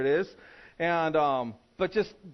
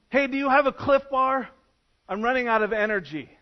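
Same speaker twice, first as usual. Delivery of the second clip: loud, high, casual ask to the boat captain; hammed-up drowning man asking for Tylenol; silly request to rescuer; 7 semitones higher, 6 dB louder than usual. Pastor mocks a drowning man asking the captain, loud and high. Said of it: "look at the captain of that boat and go".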